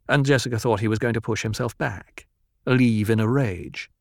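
ordinary delivery, nothing marked

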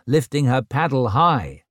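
ordinary delivery

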